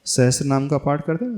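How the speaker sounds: ordinary talk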